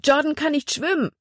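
high-pitched alarmed exclamation; frightened cry. A narrator's reading. Libby sees Jordan fall into the water, frightened cry. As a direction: cry out in fear, voice shooting up. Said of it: "Angst ergriff Libbys Herz".

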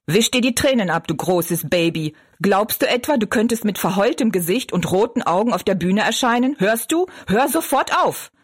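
harsh scolding, pitch rising at the end; gruff scolding. A narrator's reading. Father orders his tearful daughter to stop, gruff. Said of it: "rief er barsch".

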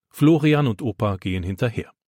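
usual speaking voice

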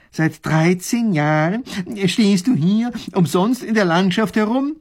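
slow, loud, high disbelief; incredulous professor. Acted. Professor questioning the soldier, loudly incredulous. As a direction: spell out the absurdity.

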